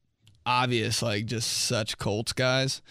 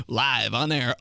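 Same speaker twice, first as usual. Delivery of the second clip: usual speaking voice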